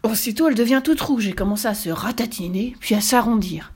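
plain reading voice

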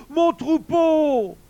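high, loud drawn-out wail; moaning bear. A narrator's reading. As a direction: wail over a dreadful loss. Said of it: "gémit l'ours". (gémissement aigu et traînant)

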